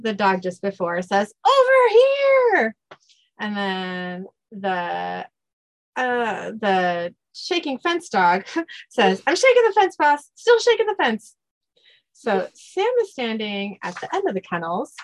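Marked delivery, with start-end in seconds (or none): 1.4-2.8 high, loud, long-drawn holler; calling out. Acted. Kennel dog calling Dean over, drawn-out holler. 9.3-11.3 high, eager comic dog voice; excited dog. Acted. Kennel dog reporting to the boss, excited.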